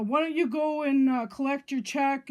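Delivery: loud, sneering jab, imitating the young man; mocking jeer. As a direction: fling the insult out loudly in a put-on voice. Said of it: "He's calling".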